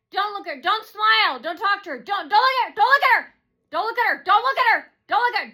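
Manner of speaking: high, loud repeated commands; mock screaming. Imitating hecklers ordering others not to look, mock screaming. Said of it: "they were screaming".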